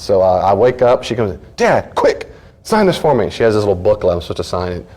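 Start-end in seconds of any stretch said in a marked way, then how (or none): 1.6-3.3 urgent daughter. Retold or imitated. Daughter rushing sleepy father.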